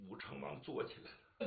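ordinary delivery, nothing marked

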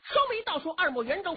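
ordinary delivery

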